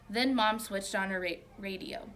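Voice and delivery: plain reading voice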